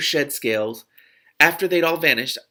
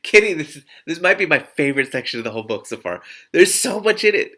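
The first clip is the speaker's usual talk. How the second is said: louder, higher rave about the book; excited gushing; 4 semitones higher, 7 dB louder than usual. Real, not acted. Reader telling Kitty this is her favourite section, excited.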